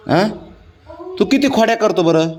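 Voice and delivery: ordinary delivery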